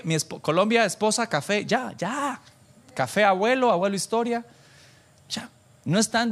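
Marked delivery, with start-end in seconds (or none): none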